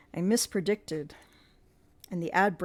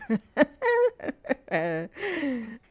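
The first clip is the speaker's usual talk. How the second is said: hideous monster laughter; 8 semitones higher than usual. Acted.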